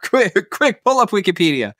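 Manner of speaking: high-pitched pretend alarm; mock panic. Co-host put on the spot, mock panic. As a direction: play at panicking, voice jumping high.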